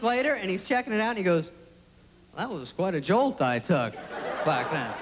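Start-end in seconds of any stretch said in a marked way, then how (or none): 2.4-4.9 low, understated testimonial; deadpan low ad testimonial; commercial spokesman. Acted. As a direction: play the injured player calmly, pitched down. Plays battered player in commercial.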